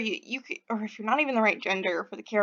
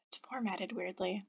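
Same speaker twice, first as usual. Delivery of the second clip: unvoiced whisper aside; 4 semitones lower, 16 dB quieter than usual. Real, not acted. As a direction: whisper it to yourself. Aside muttered over game.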